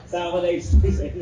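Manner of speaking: chuckling through the punchline; laughing. Real, not acted. Teacher joking to class about his wife's titles, laughing. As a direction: let a laugh break into the last title.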